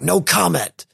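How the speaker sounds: retold snarl, lightly acted; mock snarl. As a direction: snap back in a mild snarl. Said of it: "he snarls back".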